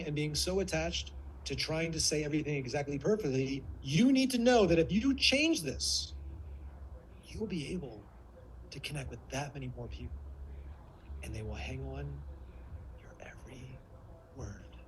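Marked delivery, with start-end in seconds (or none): 3.8-6.1 high, stretched-out, driving emphasis; emphatic warrior. Shown as a demonstration. Coach moving listeners with sound alone, emphatic warrior. 7.2-14.8 soft, low, fading almost to a whisper; hushed lover. Shown as a demonstration. Coach moving listeners with sound alone, hushed lover. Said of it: "move somebody emotionally with just the sound".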